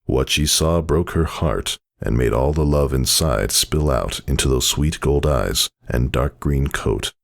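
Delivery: ordinary delivery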